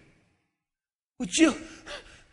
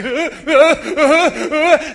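mock sobbing; 3 semitones higher, 13 dB louder, slower than usual. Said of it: "I'm like".

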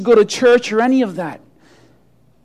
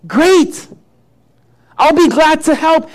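higher, lightly voiced eager chicken; 5 semitones higher, 4 dB louder, slower than usual. Chicken answering the store manager's call for help, eager. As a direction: offer help with bright-eyed keenness, pitched up.

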